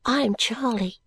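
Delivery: a hint of a small girl; soft-voiced little girl. A narrator's reading. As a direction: introduce yourself as a soft-spoken child. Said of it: "said the little girl in a soft voice".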